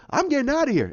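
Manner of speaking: high, hurried acted retort; Rocket. Rocket abandoning Banner, high acted retort. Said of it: "He goes".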